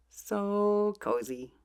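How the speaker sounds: high, slow cooing; drawn-out sweet coo; cozy coo. Acted. Cozy moment voiced to baby.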